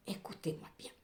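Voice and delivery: hushed, breathy repeat; whisper. Real, not acted. Tarot reader whispering the warning to her viewers. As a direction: lean in and whisper it like a secret.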